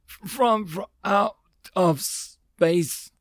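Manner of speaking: slow, high, halting strained voice; weak, broken, stuttering reply; fading consciousness. A narrator's reading. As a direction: stammer it out while about to pass out. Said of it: "replied before he lost consciousness again".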